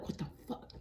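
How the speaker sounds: breathy whispered curse under the breath; whispered thought. Retold or imitated. Finding her brother asleep, a whispered curse. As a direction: whisper it like a thought you can't say aloud.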